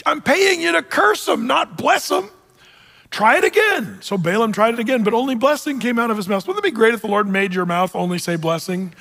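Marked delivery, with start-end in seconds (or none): 0.1-4.0 much higher, strained mock fury; furious Balak. Balak berating Balaam for blessing instead of cursing, high mock fury. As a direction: scold the hired prophet. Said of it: "Balak is furious".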